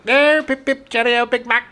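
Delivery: high-pitched mock English ad voice; hammed-up British commercial accent. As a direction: chirp it like a cartoon Brit. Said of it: "a McDonald's of England commercial".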